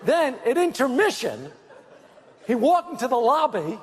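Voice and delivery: ordinary talk, nothing marked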